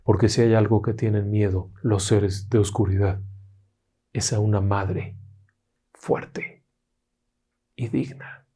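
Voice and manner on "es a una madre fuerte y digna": soft, slow hushed close; hushed moral. A narrator's reading. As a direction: let the moral sink in quietly. Dramatic closing moral.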